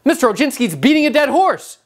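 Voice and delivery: high-pitched objection called out; mock objection yell. Defense attorney objecting to the judge, mock yell. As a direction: protest to the bench like a riled-up lawyer. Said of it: "yells out".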